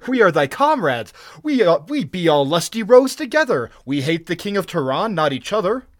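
high, loud, placating pirate; loud, fawning protest of comradeship; eager appeal. A narrator's reading. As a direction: win the captain over eagerly. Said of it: "they cried eagerly".

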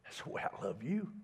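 soft, hushed, tender voice of God; hushed tender divine reassurance. Retold or imitated. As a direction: speak gently, barely above a breath, as God to a son. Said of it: "and this voice said".

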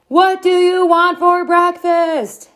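high, slow, drawn-out yell. A narrator's reading. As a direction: yell each word over the din. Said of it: "Mom yelled".

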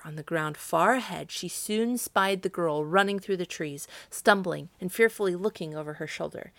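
plain reading voice

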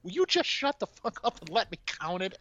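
high-pitched fed-up pleading; mock exasperated. Manager begs tenant to let him count, high mock exasperation. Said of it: "He's like".

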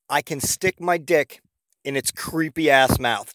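slow, word-by-word mock interrogation; incredulous recap. Boyfriend repeating her absurd accusation back, slow disbelief. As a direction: lay out each piece with long, pointed pauses.

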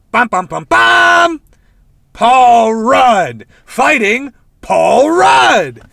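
loud, high, very slow dramatic fanfare; dramatic reveal. Pretend. Telling friends the show's twist, booming dramatic reveal. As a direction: announce the twist like a movie trailer.

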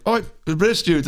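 high, badly faked British accent; shitty UK accent. British house guests answering mom upstairs, badly faked British accent. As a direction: imitate British lads with a poor accent. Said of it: "I have a shitty U K accent".

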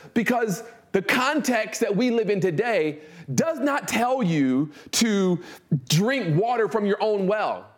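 ordinary talk

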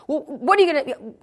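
loud, high-pitched badgering; acted exasperated outburst; irate demand. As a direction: imitate an agitated caller demanding backup. Sister presses for agreement.

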